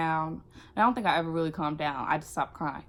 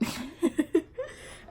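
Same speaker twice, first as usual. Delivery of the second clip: high laugh; 11 semitones higher than usual. Real, not acted. Laugh at coach reaction.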